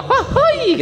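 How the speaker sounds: mock yell, shooting very high; retold whoop. Young man upstairs heckling the Friday sermon, whooping shout. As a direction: let out a high, teasing whoop. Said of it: "itu langsung teriak".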